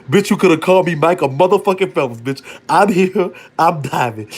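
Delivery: high, loud triumphant boast; loud gleeful bragging; excited brag. Retold or imitated. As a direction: crow it like a champion swimmer. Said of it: "bragging diving in".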